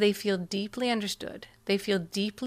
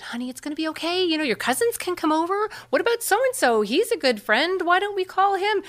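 fixing parent; 10 semitones higher than usual. Retold or imitated. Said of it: "we start talking them out of it".

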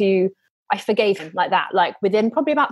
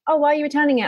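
higher shop-assistant voice; chirpy higher as the sales lady; 6 semitones higher, much faster than usual. Retold or imitated. Shop lady asking about the return, higher. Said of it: "the lady at Ann Summers went".

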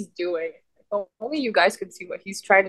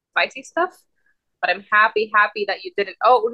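ordinary delivery

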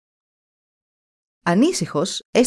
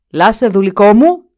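much louder call, rising on μου; shouting again; 3 semitones higher, 9 dB louder than usual. Acted. The Duke shouts a second time for Lasse, much louder. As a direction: call harder into the empty hut. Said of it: "Για δεύτερη φορά φώναξε".

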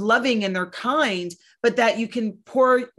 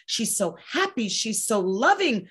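imitated doting raves, climbing higher; gushing praise; 5 semitones higher than usual. Grandparents raving about their granddaughter, gushing more and more. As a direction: build from fond to mock-effusive.

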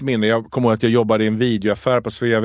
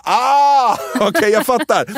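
mock high resigned voice; 9 semitones higher, 3 dB louder, slower than usual. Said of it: "Och de bara".

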